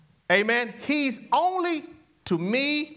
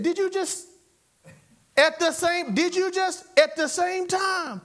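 high-pitched retold astonishment; amazed reaction; 10 semitones higher than usual. Couple realizing they both sneezed at once, amazed. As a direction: ask it in mock amazement, pitched way up.

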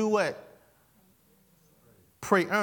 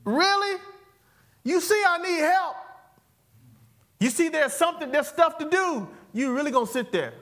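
fed-up scolding; 4 semitones higher than usual. Retold or imitated. Host scolding niece and nephew watching her cook. Acted family scolding, high and loud.

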